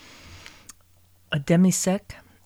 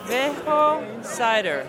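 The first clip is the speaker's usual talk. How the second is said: Spanish accent; 4 semitones higher, much slower than usual. Real, not acted. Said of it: "Probably saying it with a Spanish accent".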